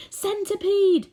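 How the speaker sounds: high, loud call of a name; yelled name. A narrator's reading. James calling the centipede for help, loud high yell. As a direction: yell it across the peach. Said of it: "yelled James".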